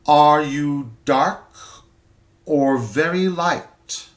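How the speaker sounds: slow, loud stress on "dark"; a landlady rephrasing with pointed emphasis; shifted emphasis. Acted. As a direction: lean hard on "dark", then ease into "or very light". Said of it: "varying the emphasis".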